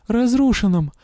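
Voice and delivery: high-pitched, sobbed answer; tearful. A narrator's reading. Patient answering the witch's question, tearful whimper. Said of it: "я всхлипнула".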